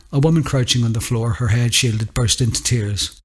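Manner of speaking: plain reading voice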